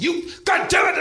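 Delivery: high-pitched enraged yell; imitated angry scream. Comic retells husband screaming at the busboy, high furious shout. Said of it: "he just starts screaming at me".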